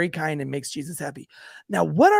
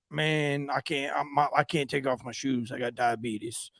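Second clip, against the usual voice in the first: softer, lower mumbled imitation; druggie; 4 semitones lower, 6 dB quieter than usual. Blind man refusing to remove shoes, quiet low excuse. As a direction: make a feeble, low-key excuse. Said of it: "he's like".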